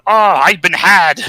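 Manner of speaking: hammed-up cry of a fooled villain; mock villain outrage. Robotnik finding out he was tricked, mock outrage.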